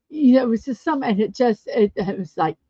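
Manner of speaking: ordinary talk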